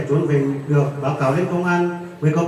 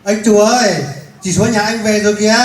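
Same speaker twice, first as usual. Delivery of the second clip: loud call across the yard, higher; 4 semitones higher, 5 dB louder than usual. Acted. Neighbour calling to the husband that his wife is back, loud call. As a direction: shout the news to him from outside.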